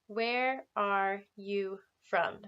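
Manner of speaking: very slow, clear teacher modelling; slow model question. Shown as a demonstration. Teacher modelling a question slowly for students. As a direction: stretch each word for the class. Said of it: "say that with me".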